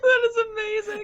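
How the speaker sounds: laughing. Real, not acted. Laughing at the Prius punchline.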